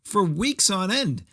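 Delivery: high-pitched exasperated rant. Real, not acted. Podcaster rants at a movie kid's lie, exasperated. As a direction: demand to know why he lied.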